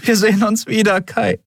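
high, emotional farewell; high-pitched parting call; tearful promise. A narrator's reading. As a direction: promise it while being carried away. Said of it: "versprach sie".

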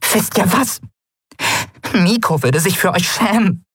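high, bitter little voice; high-pitched, wounded fury; angry. A narrator's reading. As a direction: shame them in a high, angry voice. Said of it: "die Wut, die ihren Körper zittern ließ".

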